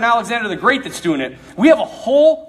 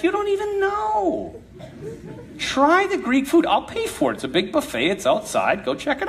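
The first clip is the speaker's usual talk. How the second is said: coaxing conqueror; 7 semitones higher, 5 dB quieter than usual. Acted. Alexander coaxing conquered people.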